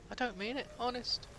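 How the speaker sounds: mock pleading. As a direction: plead innocence in a high, playful voice. Backtracking on teasing Australian audience.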